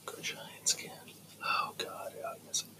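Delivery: soft unvoiced whisper. Real, not acted. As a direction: whisper it under your breath. Muttered aside, unvoiced whisper.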